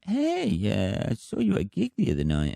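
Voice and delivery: high-pitched imitation of a neighbour; neighbour's greeting. Neighbour tells him she saw his gig, high mock greeting. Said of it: "she saw me at a gig one night and then went".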